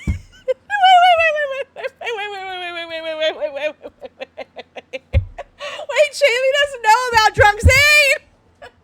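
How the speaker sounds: high, loud, rapid-fire excited waits. Real, not acted. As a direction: squeal it out, laughing in disbelief. Excited over a chat question.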